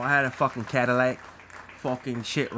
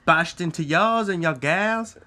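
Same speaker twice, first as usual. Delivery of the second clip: mock Australian; 5 semitones higher, 3 dB louder, slower than usual. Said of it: "you guys have some accents going on".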